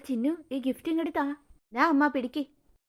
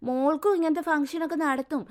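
ordinary talk, nothing marked

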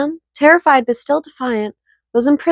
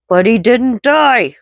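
dramatic exclaim; 4 dB louder, slower than usual. Acted. Dramatic outburst after story.

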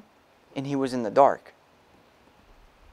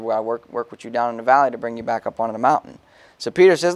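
ordinary delivery

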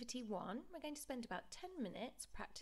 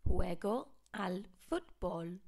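projected model phrase; 10 dB louder than usual. Shown as a demonstration. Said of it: "So it sounds like an H".

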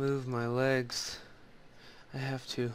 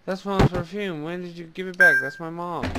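higher, louder sudden shock; 7 semitones higher, 7 dB louder than usual. Real, not acted. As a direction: blurt it out in alarm. Shocked at mother's perfume.

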